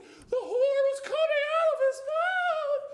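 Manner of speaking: mock-tragic high, loud wail; overacted horror. Imitating the actress describing the horn, overacted. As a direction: ham it up, slow and overwrought. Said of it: "She's doing, like, this".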